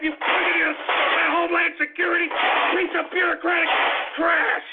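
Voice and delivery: frenzied angry shouting; furious tirade. Acted. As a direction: rage as if attacking someone. Acts out furious attack while ranting.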